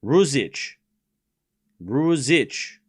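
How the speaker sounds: Ruzic in a put-on accent, z in the middle. Shown as a demonstration. Streamer trying to get a Croatian name right, put-on accent. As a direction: attempt a foreign accent on Ruzic. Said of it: "I just gotta put on an accent".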